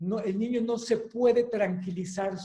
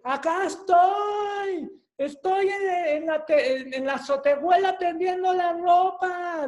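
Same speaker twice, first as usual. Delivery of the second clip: high, loud, slow answer called from far away; mamá grita desde lejos; 9 semitones higher, 4 dB louder than usual. Retold or imitated. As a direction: call back to the kid from the rooftop. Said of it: "la mamá dice". (la mamá responde a gritos, aguda y lenta, desde la azotehuela)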